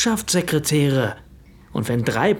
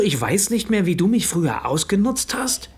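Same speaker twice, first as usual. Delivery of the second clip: ordinary talk, nothing marked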